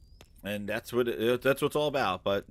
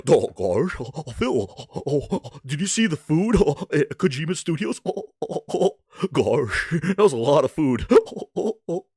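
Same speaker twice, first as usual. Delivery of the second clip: lightly voiced dopey Goofy; a hint of dopey cartoon dog; 2 semitones lower than usual. Greenberg mocked as Goofy.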